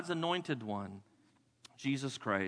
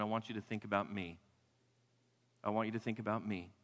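soft intimate plea; 6 semitones lower, 6 dB quieter than usual. Acted. Jesus voiced, intimate plea.

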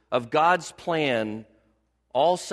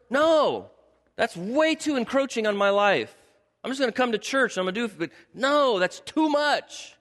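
high-pitched, emphatic mock objection; acted overwhelmed churchgoer digging in; protesting refusal; 9 semitones higher than usual. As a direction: push back hard, stretching the last words. Acting out a listener's refusal.

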